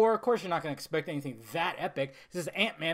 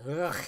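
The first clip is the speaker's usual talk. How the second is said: disappointed "ugh"; 3 semitones lower than usual. Real, not acted. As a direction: sigh out a grunt of frustration. Frustrated with the film.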